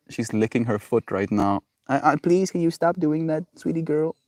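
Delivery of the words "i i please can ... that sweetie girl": soft, high sweet pleading; coaxing pet talk. Real, not acted. Owner asking pet to stop licking, soft high coaxing. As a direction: plead gently in a small high voice.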